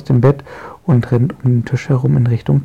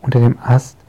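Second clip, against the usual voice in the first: plain reading voice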